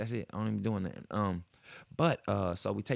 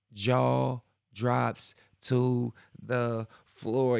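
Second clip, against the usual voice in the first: very slow, louder, word by word; stunned emphasis; 5 dB louder, much slower than usual. Retold or imitated. Storyteller learns her dad owns a tire shop, slow stunned emphasis. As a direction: drop each word separately for dramatic effect.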